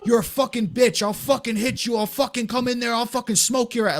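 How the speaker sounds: high-pitched acted threats; going in on him. Fake neighbor threatening Phil at his door, high-pitched tirade. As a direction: rattle off threats in a mock rage. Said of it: "going in on him".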